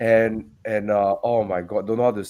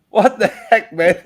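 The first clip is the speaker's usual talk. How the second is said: laughing out loud, much higher and louder; delighted laughing outburst; 8 semitones higher, 6 dB louder, slower than usual. Real, not acted. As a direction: crack up in disbelief. Laughing at roofless spider.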